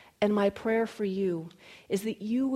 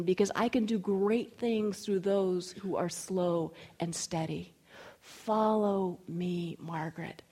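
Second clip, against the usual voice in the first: slow, deliberate, reassuring command; the Spirit; slower than usual. Acted. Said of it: "it was like the Spirit reminded me".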